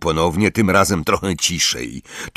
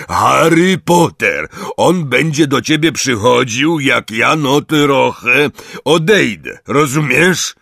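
loud simple explaining; 6 semitones higher, 5 dB louder than usual. A narrator's reading. Hagrid explaining Harry's visits to the giant, loudly.

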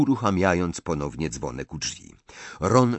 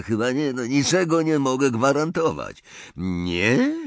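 ordinary delivery, nothing marked

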